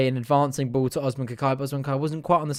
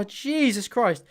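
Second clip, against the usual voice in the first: exasperated; 7 semitones higher, much slower than usual. Real, not acted. After missed chance.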